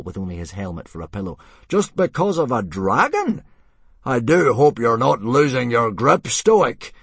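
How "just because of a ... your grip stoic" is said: loud, high-pitched scornful jibe; mocking, blustering challenge; sneering. A narrator's reading. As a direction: sneer it out for the crowd to hear. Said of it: "he sneered".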